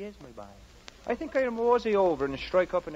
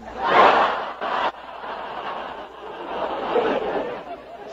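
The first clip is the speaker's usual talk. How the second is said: laugh; 4 dB louder than usual. Acted. Big laugh at pun.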